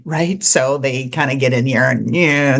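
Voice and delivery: ordinary talk, nothing marked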